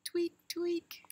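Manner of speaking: high squeaky trunk tweaks; squeaky baby elephant. Acted. Little elephant trunk signal.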